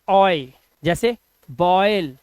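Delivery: usual speaking voice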